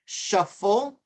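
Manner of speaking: normal talking voice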